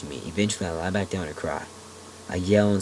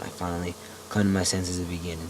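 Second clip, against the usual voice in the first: plain reading voice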